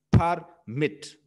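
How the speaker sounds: "Permit" split into per and mit; syllable by syllable. Shown as a demonstration. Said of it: "one vowel sound in per and the second vowel sound in mit".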